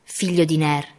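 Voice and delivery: usual speaking voice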